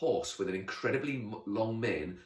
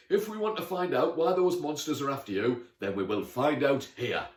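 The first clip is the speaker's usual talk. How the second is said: resolute knight; 6 semitones higher, 6 dB louder than usual. Acted. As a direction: declare it like a questing hero.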